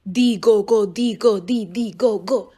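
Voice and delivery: lightly chanted drum rhythm; mild drum call. A narrator's reading. Narrator voicing the Ekwe drum, lightly chanted. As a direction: tap the words out like a slit drum.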